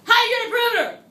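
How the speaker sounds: high, loud call-out voice; weird voice. Shown as a demonstration. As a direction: shout it in a silly high voice. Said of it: "We say it in a weird voice".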